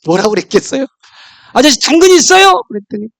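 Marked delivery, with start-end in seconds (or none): 1.5-2.5 much higher and louder, sing-song rabbit question; cheeky rabbit voice. Acted. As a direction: chirp it like a stubborn little rabbit. Rabbit asks third time.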